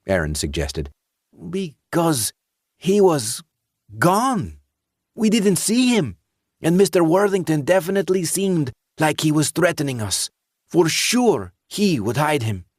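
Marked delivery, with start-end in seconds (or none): 1.5-12.6 put-on higher, eager voice of a worried teenage boy; anxious teenage boy. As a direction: play the nervous teen insisting to the sheriff. Teen character voiced by narrator.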